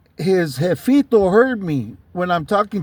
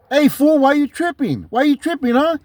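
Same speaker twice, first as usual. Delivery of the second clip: higher-pitched mock challenge; puffed-up confrontation; 6 semitones higher than usual. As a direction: act out getting all puffed up at someone. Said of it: "he got all puffy on me".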